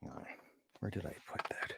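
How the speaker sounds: whispered aside to himself; whispering. Real, not acted. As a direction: mutter it under your breath. Muttering to himself mid-reading.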